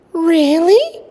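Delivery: high, rising surprised really; surprised mother. A narrator's reading. Mother reacting to the wedding news with surprise. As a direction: sound amazed at the news.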